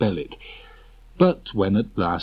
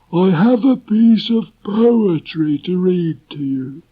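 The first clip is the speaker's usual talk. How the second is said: very high, loud, slow pompous donkey voice; a gloomy donkey speechifying importantly; Eeyore making a speech; 11 semitones higher, 6 dB louder, slower than usual. Acted. Eeyore addresses the meeting, slow and self-important.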